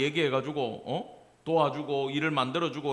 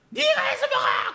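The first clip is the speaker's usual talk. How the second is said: angry mother's shout; 7 dB louder than usual. Retold or imitated. Mother snaps at grumbling child.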